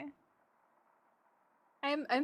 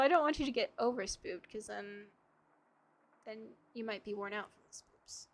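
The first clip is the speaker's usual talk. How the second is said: usual speaking voice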